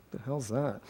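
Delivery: imitating baffled guests, softly; muttered aside. Puzzled wedding guests reacting to his title, muttered aside. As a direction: drop it low like a puzzled onlooker.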